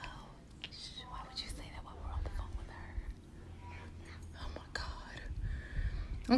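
hushed scolding whisper. Real, not acted. Mom scolding her child so the teacher can't hear, whisper. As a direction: keep it off the call, whisper it. Parent hushing child during call.

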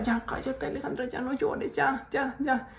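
softly acted self-hushing; hushed self-soothing. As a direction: quietly retell telling yourself to stop crying. Retold hushing herself while crying.

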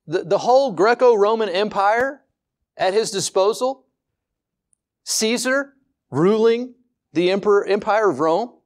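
high, rising rhetorical questions; incredulous. Acted. Preacher marvelling that God passed over Rome's empire, incredulous. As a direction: pile up the options in lifted disbelief. Contrasting Rome's might with humble Nazareth.

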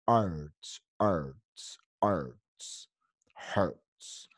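"arts" as a low r-coloured "erts", then "hurts"; separate, low-pitched repeats of the "er" in "hurts"; isolated er sound. Shown as a demonstration. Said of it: "el sonido. Es".

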